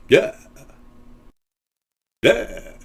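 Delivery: slow, drawn-out vampire voice demo; Count Dracula accent. Shown as a demonstration. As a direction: drag it out like a vampire. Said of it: "sounds more like this".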